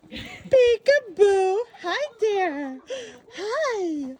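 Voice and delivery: high, slow sing-song greeting. Real, not acted. Greeting animals playfully.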